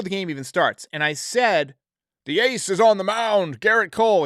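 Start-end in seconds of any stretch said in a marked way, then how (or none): 2.2-4.2 lightly hammed-up podcast announcer; podcaster voice. Host re-enacting his own recorded game intro, podcaster voice. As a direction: proclaim it like a show intro, a touch performed. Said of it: "in my little podcaster voice".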